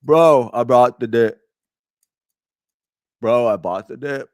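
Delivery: crypto bro. Pretend. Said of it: "Do I sound like a crypto bro?".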